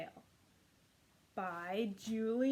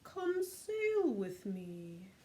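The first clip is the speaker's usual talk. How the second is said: gentle whale; much slower than usual. Acted. Whale inviting the snail to ride on his tail. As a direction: coax the snail aboard. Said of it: "said to the snail".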